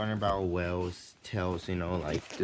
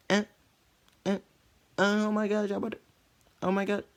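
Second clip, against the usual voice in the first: very high, louder strained squeal; weird noise; 5 dB louder, faster than usual. Retold or imitated. Boy making weird noises after workout, high squeal. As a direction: squeak it out like something's wrong. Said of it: "I say some weird noise".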